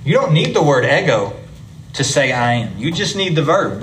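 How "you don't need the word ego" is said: normal talking voice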